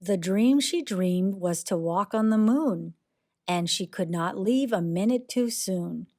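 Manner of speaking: plain reading voice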